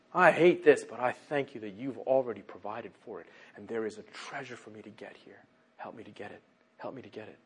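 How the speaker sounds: falls away into a low, soft plea; hushed prayer. Acted. Believer asks the Father for help in trouble, hushed prayer. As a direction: begin at speaking level, then sink to a humble near-murmur.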